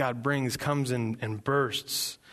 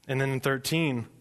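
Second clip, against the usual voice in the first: ordinary talk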